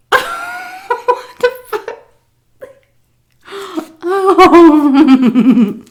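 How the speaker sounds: a high, laughing "what the fuck", drawn out slow; cracking up in disbelief. Real, not acted. Reacting to the boss's offer, laughing in shock.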